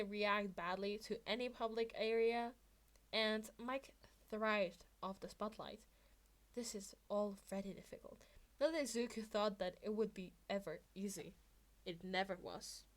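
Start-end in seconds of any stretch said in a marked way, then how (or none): none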